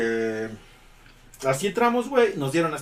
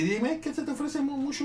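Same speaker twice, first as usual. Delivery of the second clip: much higher cooing voice for a puppy; talking to the puppy; 12 semitones higher than usual. Real, not acted. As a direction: coo it up high like talking to a pet. Owner talks to the puppy. (voz aguda y cariñosa para el cachorro)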